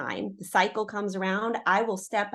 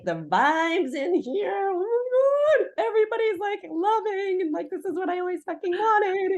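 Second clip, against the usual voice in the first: much higher, gushing with a laugh; gushing excitement; 12 semitones higher than usual. Retold or imitated. Telling friends about family bliss, gushing high and giddy. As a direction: rave about it, overjoyed.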